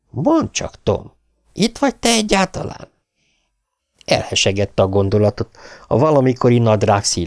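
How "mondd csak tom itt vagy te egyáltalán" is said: high, searching question to her husband; wife's voice, high and probing. Acted. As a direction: ask whether he is even there. Beverly asks.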